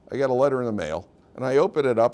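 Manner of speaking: ordinary delivery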